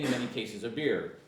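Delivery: ordinary talk, nothing marked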